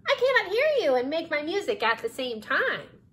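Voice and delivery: high little cricket voice; high-pitched cricket straining to hear; oblivious cricket. A narrator's reading. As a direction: call it out high, as if over noise.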